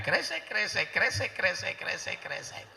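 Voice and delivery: repeated rustle trailing away; imitated sandal shuffling. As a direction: mimic sandals scraping along. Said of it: "suara gesekan sendalmu".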